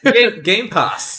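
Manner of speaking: high, loud, slow announcer call; mock announcer. Co-host jokingly announcing the next topic in a high, loud, slow voice.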